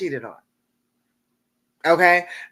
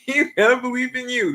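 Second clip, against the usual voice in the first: higher, laughing as she says it; laughing encouragement; 6 semitones higher than usual. Real, not acted. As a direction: cheer them on through a laugh. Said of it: "repeated encouragement to viewer".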